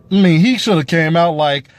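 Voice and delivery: ordinary delivery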